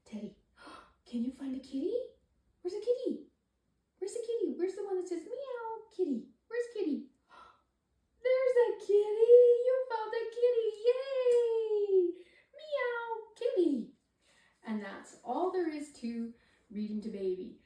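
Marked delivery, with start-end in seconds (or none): 0.1-7.0 high, sing-song questions; baby talk. Shown as a demonstration. Parent asking baby to find a kitty, in high sing-song. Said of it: "make it interesting using your voice". 8.2-13.9 high, loud delighted cheer; excited cheering. Shown as a demonstration. Parent cheering baby for finding the kitty, loud and high. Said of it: "make it interesting using your voice".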